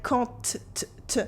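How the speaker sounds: "quand t, t, t" with the final T sounded; liaison T sound. Shown as a demonstration. Teacher showing the French liaison, "quand t, t, t" with T pronounced. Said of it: "with the T sound".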